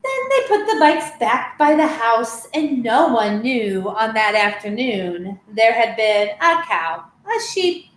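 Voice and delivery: lively storybook read-aloud with voiced animals; reading to children. Teacher reading a picture book to children, lively. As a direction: tell the tale brightly to little listeners.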